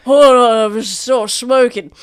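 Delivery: put-on old cook shouting. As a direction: bellow it like a grumpy cook. Old cook chasing rat.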